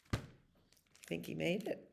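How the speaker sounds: ordinary delivery, nothing marked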